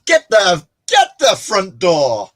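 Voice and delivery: loud, drawn-out astonishment; high, loud outburst, stretching the words; mock disbelief. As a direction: exclaim in exaggerated disbelief. Mock shock at Spider-Man fact.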